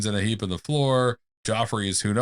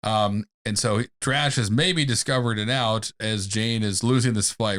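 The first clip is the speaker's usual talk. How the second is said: normal talking voice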